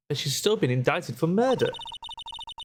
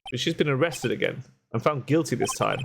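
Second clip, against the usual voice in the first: ordinary delivery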